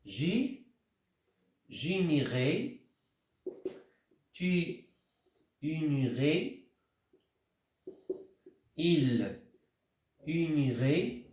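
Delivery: each form drawn out slowly with pauses; slow, spaced-out conjugation for the class; slow dictation. Shown as a demonstration. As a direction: dictate slowly so students can write. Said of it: "on va dire".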